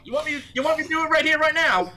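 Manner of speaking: very high, fast mock-fight voice; high squeaky dare; high taunting challenge.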